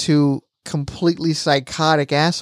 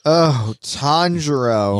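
slow, drawn-out mock swoon; Zenitsu cooing; much slower than usual. Retelling Zenitsu fawning on his friend, drawn-out mock swoon. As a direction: stretch the name out like a hammed-up admirer.

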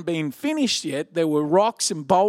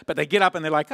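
ordinary delivery

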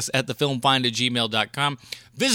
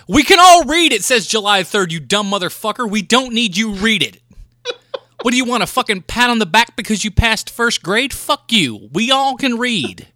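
angry rant; 6 semitones higher, 5 dB louder than usual. Real, not acted. Ranting at screen readers.

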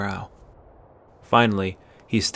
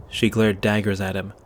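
ordinary delivery, nothing marked

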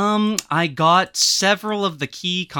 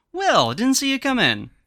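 higher, louder mock-surprised greeting; 9 semitones higher, 5 dB louder than usual. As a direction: play along, hailing someone who just walked in. Greets an imaginary absent co-host arriving.

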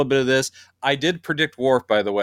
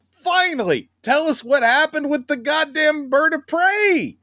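much higher, eager fan plea; excited high pleading; 13 semitones higher than usual. Retold or imitated. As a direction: beg for the story in a high excited voice. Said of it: "they're like".

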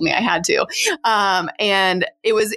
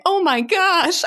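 high, astonished retold exclamation; amazed disbelief; 11 semitones higher, slower than usual. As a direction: gasp it out as if seeing a wonder. Retells first sight of baby's head.

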